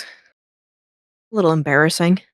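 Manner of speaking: put-on bashful young lord, drawn out; Dirk's voice. Young lord embarrassed in the big jacket, slow and shy. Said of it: "he kinda looks and he goes".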